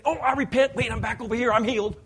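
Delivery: high, loud, hurried correction; mock frantic backtracking. Pastor mimics believer reclaiming healing, loud frantic correction. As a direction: scramble to take it back. Acts out someone scrambling to claim healing.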